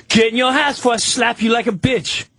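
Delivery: angry neighbour yelling a threat; threat shout. Retold or imitated. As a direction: bark the threat at him like a thug. Albino threatening speaker.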